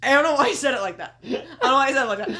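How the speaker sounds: baffled and cracking up; real laughing. Real, not acted. Host baffled by how the wrestler said it, laughing.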